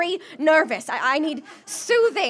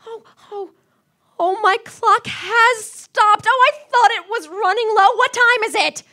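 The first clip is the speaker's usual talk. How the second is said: loud, higher startled questions; sudden alarm over the time; alarmed surprise; 5 semitones higher, 6 dB louder than usual. Acted. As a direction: catch the shock of the stopped clock. Shock at the stopped clock.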